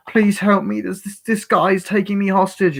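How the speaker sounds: hammed-up high-pitched pleading; mock pleading. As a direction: imitate a hostage begging for help. Retelling fake hostage's plea for help.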